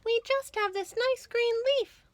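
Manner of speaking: high, small ants' voice; tiny insects answering politely; ants replying. A narrator's reading. Ants answer the rat in high little voices. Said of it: "the ants replied".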